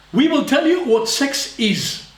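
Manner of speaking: high, slow, mock-commanding decree; mock authoritarian government. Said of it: "And they're fighting back".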